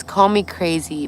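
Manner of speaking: normal talking voice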